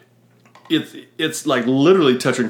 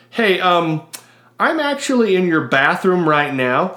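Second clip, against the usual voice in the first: higher, slow, hesitant phone voice; imitated awkward caller; 5 semitones higher, slower than usual. Stall stranger phones the front desk, imitated awkward caller. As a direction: mimic a caller carefully explaining an awkward problem.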